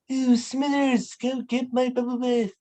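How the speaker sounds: put-on a wheezy old boss giving orders; Mr Burns impression. Shown as a demonstration. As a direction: Mr Burns demanding his bath. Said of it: "let's go with".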